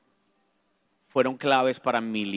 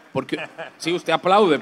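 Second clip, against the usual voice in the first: ordinary talk, nothing marked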